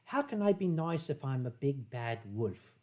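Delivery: low, soft, poorly imitated posh accent; Silver Fox Wolf's posh accent. Reading a friend's chat message, in an attempted posh accent. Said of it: "I cannot do his posh accent".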